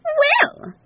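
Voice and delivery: very high sulky squeal; put-out lady cow. A narrator's reading. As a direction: huff in a high-pitched cow voice. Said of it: "in a sulky voice".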